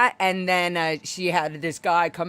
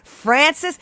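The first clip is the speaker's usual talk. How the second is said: loud, higher, slow confrontation; a raised voice challenging the owner; confronting shout; 8 semitones higher, 9 dB louder, slower than usual. Retold or imitated. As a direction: call her out in front of the diners. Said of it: "I argued with her in front of everybody".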